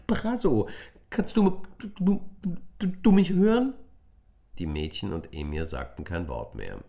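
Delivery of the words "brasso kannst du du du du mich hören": high, loud, frantic calls; desperate calling. A narrator's reading. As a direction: stammer it out in panic.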